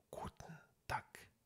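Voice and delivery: Guten Tag with a hard K-like G, slow; hard northern German. Shown as a demonstration. Showing the northern German harsher Guten Tag. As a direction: harden the G to a K. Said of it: "de façon beaucoup plus dure".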